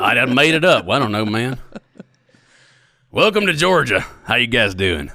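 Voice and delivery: hammed-up Georgia Southern accent. Guest welcoming hosts to Georgia, Southern accent. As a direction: play the friendly Georgia local.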